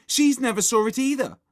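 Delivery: put-on high, loud child's taunt; tattling accusation. A narrator's reading. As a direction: tell on your friend loudly. Susan tells on Margaret, quarrel.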